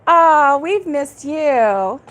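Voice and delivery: ordinary delivery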